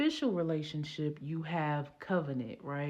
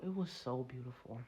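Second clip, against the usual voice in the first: hushed echo; 5 semitones lower, 10 dB quieter than usual. Shown as a demonstration. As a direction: drop to a soft, low murmur. Repeats the line softer.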